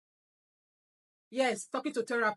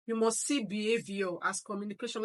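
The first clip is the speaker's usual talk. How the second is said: normal talking voice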